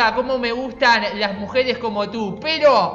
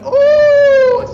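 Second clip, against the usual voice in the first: very loud, high sustained "uh"; piercingly high, held battle whoop; high-pitched whoop; 11 dB louder than usual. Real, not acted. Gamer attacking the boss, high loud whoop.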